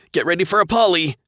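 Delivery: high, loud warning shout; urgent warning. A narrator's reading. Pilot warning his gunner, loud shout. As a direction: yell the warning to the crew.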